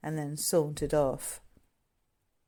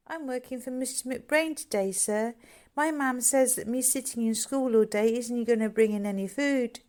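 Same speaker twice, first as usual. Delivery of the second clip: put-on higher, timid little-boy voice; a small boy fibbing politely; young boy covering for truancy; small boy lying; 7 semitones higher than usual. A narrator's reading. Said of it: "George lied".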